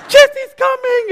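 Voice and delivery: put-on squealed high and loud like a kid at a candy store; excited child. Pastor mimics Ange bursting with excitement about Jesse, high excited squeal. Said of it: "like a little child in a candy store".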